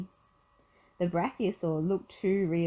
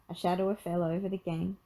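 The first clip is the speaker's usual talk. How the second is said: usual speaking voice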